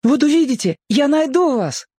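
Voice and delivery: put-on very high, eager boy's voice; hurried boy. A narrator's reading. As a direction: promise it as an urgent child. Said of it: "торопливо сказал мальчик". (торопливо, голосом мальчика)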